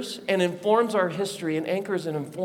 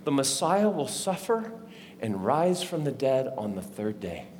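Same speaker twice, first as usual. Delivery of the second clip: low, hushed, measured reading; poignant scripture reading; 4 semitones lower, 4 dB quieter than usual. Acted. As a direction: read it solemnly, with quiet weight. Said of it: "he turns to a poignant moment".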